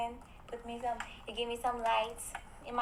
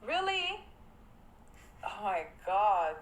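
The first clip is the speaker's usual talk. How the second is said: ordinary talk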